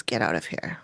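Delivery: very low, soft, menacing-ghost impression; deep man's voice. Podcaster retelling the ghost's order to a listener, deep man's voice. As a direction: drop right down to imitate a deep male voice. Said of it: "this deep man's voice comes to her".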